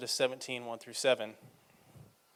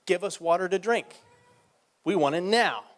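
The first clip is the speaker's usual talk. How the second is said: loud, higher demanding shout; angry crowd demand; 5 semitones higher, 5 dB louder than usual. Acted. Thirsty people shouting at Moses, angry demand.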